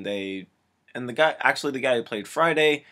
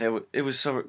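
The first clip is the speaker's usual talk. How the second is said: ordinary talk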